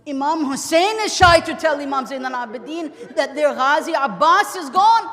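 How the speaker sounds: high, loud lamenting outcry, rising to the end; anguished cry. Real, not acted. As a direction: cry out the loss at full force, stretching the last words. Preacher cries out the death.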